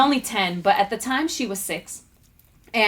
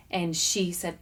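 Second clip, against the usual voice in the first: ordinary talk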